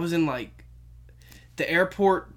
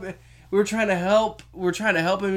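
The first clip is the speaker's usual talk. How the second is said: usual speaking voice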